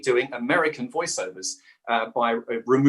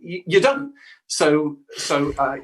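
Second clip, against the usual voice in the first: ordinary talk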